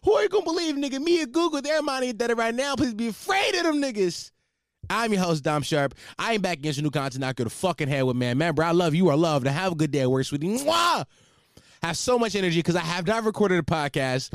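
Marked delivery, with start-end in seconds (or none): 0.0-4.3 mock-indignant boast. Host hyping the podcast to listeners, mock-indignant boast. 10.5-11.0 exaggerated high-pitched mwah; big kiss sound. Host sending listeners off to work, playful kiss.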